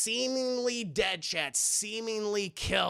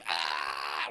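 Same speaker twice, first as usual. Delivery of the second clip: low, slow growling grunt of effort; deep mock stab grunt. Michael Myers stabbing Rudy, low mock grunt. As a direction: grunt as the knife goes in.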